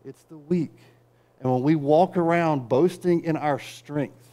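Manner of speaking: usual speaking voice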